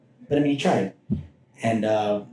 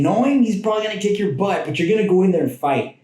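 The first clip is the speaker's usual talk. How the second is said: rally yourself to fight; real passion rising; fired up; 8 semitones higher than usual. Real, not acted. Passionate about wrestling mentality.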